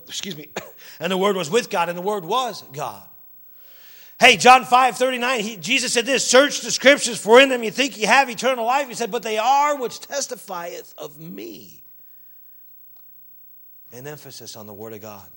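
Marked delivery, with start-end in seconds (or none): 1.0-11.8 raised, loud Bible quoting; emphatic, pitched-up preacher voice; forceful scripture preaching. Real, not acted. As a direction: proclaim the verse to the congregation.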